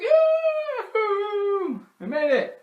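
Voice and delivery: high, loud celebration; mock triumphant cheer. Family reaching the plane in time, excited cheer. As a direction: cheer like you just caught it.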